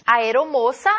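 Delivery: normal talking voice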